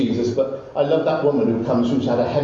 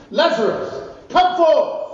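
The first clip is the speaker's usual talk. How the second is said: high, raised call to the dead; commanding call. Acted. Preacher voicing Jesus calling Lazarus from the tomb, commanding call. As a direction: call him out with authority.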